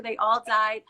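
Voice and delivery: normal talking voice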